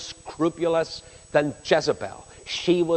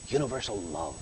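universal love dropped much lower and softer, almost to himself; quiet echo; 9 semitones lower, 13 dB quieter than usual. Shown as a demonstration. Preacher musing on the singer's hope, quiet and low. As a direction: let it fall away like a wistful aside. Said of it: "Or, you know".

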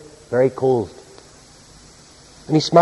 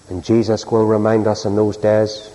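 normal talking voice

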